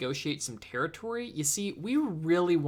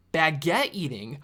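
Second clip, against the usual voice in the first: usual speaking voice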